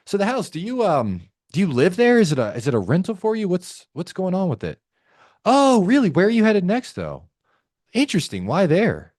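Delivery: high, lively, genuinely interested questioning; curious, pitch leaping up on reactions. Shown as a demonstration. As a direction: ask like you genuinely care. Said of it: "This is a curious tone".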